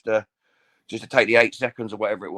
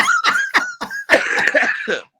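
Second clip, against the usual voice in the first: real choking cough, loud and high; 7 dB louder than usual. Real, not acted. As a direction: splutter as if something went down the wrong way. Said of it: "Sorry, I just choked".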